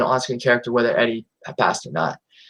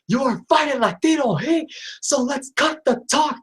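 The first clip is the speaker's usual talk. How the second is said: Eddie Guerrero impression; 7 semitones higher than usual. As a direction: hype yourself up like a swaggering luchador taunting his opponent. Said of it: "I'll do my best Eddie impersonation".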